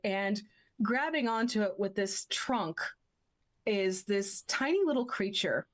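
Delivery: normal talking voice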